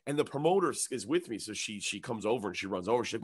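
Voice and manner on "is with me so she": usual speaking voice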